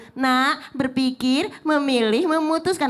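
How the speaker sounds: very slow, drawn-out berpikir, memilih, memutuskan; slow for a small child. Shown as a demonstration. Mother teaching a small child, very slow and drawn out. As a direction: spell it out slowly like to a kindergartner. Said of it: "masa anak T K begitu".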